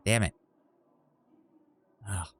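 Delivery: usual speaking voice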